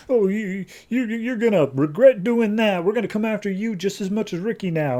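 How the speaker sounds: high-pitched put-on goon voice; mock sore-loser gangster, stammering at first; beaten thug's threat. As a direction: sputter a hammed-up threat in a high voice. Retold threat from beaten gang member.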